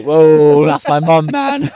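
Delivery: squeaky voice break; voice crack. Real, not acted. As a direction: voice cracking up high. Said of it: "Voice cracked on the podcast".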